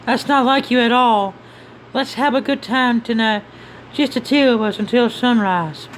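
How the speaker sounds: high, inviting character voice; coaxing companion. Acted. Companion proposing a night for two until sunrise, high coaxing voice. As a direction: invite them along in a high voice.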